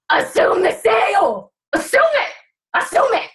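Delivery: high, punchy insistence; fired-up emphatic. Real, not acted. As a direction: drive the mantra home, rising with fire. Fired-up sales coaching, repeating mantra.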